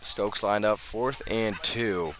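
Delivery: usual speaking voice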